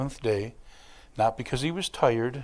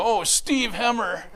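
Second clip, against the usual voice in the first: slow, higher joking cry; mock exclamation; 9 semitones higher than usual. Pastor joking about being run over, mock cry. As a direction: wail a name as a pretend curse. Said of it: "I get run over by a car and they go".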